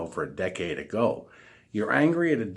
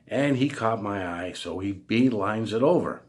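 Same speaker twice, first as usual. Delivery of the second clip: ordinary talk, nothing marked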